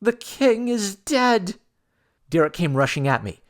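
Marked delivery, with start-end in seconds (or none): 0.0-1.6 high, slow panicked shriek; screaming girl. A narrator's reading. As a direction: scream the news in horror. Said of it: "A girl screaming and then shouting".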